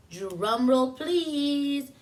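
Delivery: usual speaking voice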